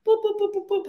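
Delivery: string of high boop noises; rapid cartoonish boops dropping at the end; squeaky little hype boops; playful boops.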